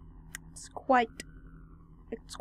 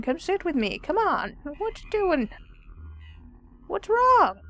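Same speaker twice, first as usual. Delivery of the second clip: high-pitched calling out; 11 semitones higher than usual. Real, not acted. Player calls to Sitaro to come sit down, high-pitched pleading. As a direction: call out urgently in a high voice.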